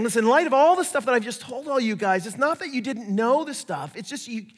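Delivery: Paul encouraging. Retold or imitated. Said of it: "He's basically saying".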